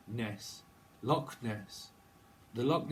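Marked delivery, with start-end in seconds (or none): none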